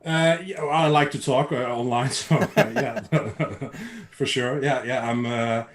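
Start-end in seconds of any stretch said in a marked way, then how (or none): 0.6-4.6 laughing. Real, not acted. Laughs about liking to talk online.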